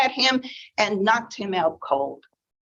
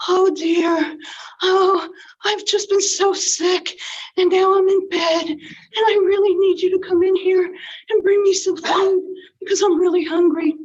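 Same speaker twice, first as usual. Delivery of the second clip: put-on higher and louder, a wolf faking a frail old lady; wolf's grandma voice; 10 semitones higher, 4 dB louder than usual. Wolf in bed fooling Red, fake old granny voice. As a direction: fake a sickly granny to lure her in. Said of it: "in his best grandma voice".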